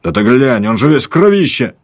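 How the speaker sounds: rising high shout of alarm; soldier yelling. Acted. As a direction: shout it out, shocked. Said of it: "заорал солдат".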